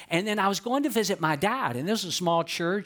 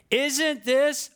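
"Isn't this" pushed high and loud, rising at the end; emphatic question; 10 semitones higher, 4 dB louder than usual. Shown as a demonstration. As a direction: lean hard on "Isn't this". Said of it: "what are those two words? Say it out loud".

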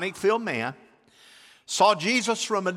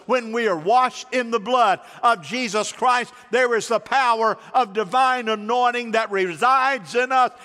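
rising, louder sermon build; preaching at full force; 4 semitones higher, 4 dB louder than usual. Acted. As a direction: climb to a preaching peak.

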